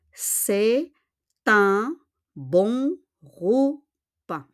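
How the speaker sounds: "un bon repas" drawn out very slowly; slow model sentence. Shown as a demonstration. Teacher slowing the phrase down for learners. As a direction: stretch each word for the class.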